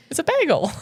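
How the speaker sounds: high-pitched "bagel" as BAG-ul; Midwestern pronunciation. Real, not acted. Co-host defending her word, Midwestern "bagel" as BAG-ul. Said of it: "You are saying it so Midwestern".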